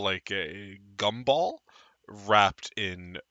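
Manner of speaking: ordinary talk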